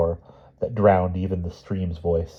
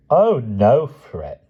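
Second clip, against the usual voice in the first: higher, mocking villain taunt; menacing villain voice; 5 semitones higher, 6 dB louder, much slower than usual. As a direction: sneer it like a gloating enemy. Harbin taunts Wilby.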